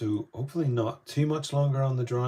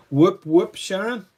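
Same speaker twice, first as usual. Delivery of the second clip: excited cheer; 11 semitones higher, 14 dB louder, slower than usual. Real, not acted. Cheering a viewer's greeting.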